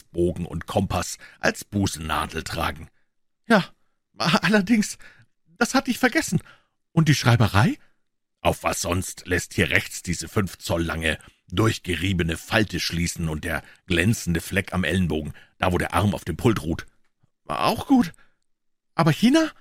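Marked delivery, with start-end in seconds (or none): 3.5-7.8 higher-pitched eager pawnbroker; Wilson's voice. A narrator's reading. Wilson, the pawnbroker, answering Holmes, in a higher voice. 17.5-19.5 higher-pitched eager pawnbroker; Wilson's voice. A narrator's reading. Wilson, the pawnbroker, answering Holmes, in a higher voice.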